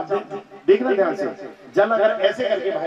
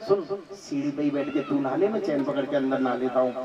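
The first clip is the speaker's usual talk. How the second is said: husband's lines dropped lower and softer; coaxing husband; 6 semitones lower, 7 dB quieter than usual. Acted. Husband gently coaxing his contrary wife, lower and softer. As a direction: talk her into staying on the steps, low and soft.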